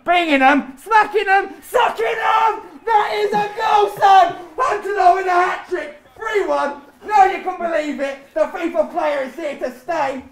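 high, shouted celebration; jubilant, high-pitched hype; ecstatic goal commentary. Real, not acted. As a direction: scream the goal like a match commentator. Hat-trick goal commentary, celebrating.

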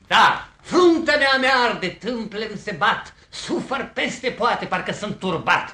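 high, impassioned lover's lament; feverish declaiming. Acted. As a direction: declaim the torment melodramatically, loud at the start. Lovesick suitor declaims his torment.

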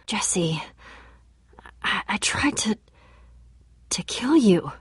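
breathy ashamed whisper. Acted. Friend whispers a guilty confession to Jesse, whispering. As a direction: confess it under your breath, barely voiced. Confessing after being mind controlled.

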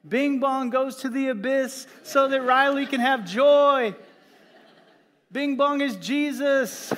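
high, loud mock-tearful wail; hammed-up sobbing. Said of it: "and I'm sobbing".

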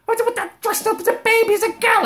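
very high, fast squeal of disbelief. Retold or imitated. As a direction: shriek it in outrage.

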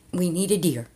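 normal talking voice

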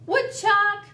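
loud, high call. A narrator's reading. Turtle calling up to Woodchuck on the hill, calling out. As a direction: call to someone far up the hill. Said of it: "called Turtle".

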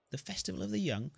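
high, surprised repeat of the name; surprised echo. Acted. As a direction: repeat it in disbelief. Hero echoing surprised.

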